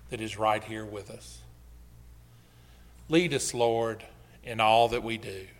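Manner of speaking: low, softened praying voice; prayer. Real, not acted. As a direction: lead the congregation humbly in prayer, low and hushed. Leads the congregation in prayer.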